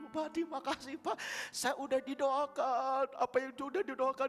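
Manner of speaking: grateful woman. Retold or imitated. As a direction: thank the pastor sweetly. Grateful woman voiced by pastor. (ibu berterima kasih dengan suara tinggi dan pelan)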